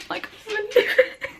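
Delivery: high, tearful laugh-cry of joy; laughing through tears. Real, not acted. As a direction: let the happy tears break into the words. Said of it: "I don't know if I've cried on camera before".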